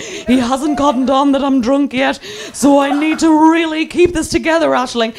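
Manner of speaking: put-on high, urgent drunken self-talk; mock panic. Drunk passenger telling herself to hold on, panicky. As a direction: talk yourself down in hammed-up desperation.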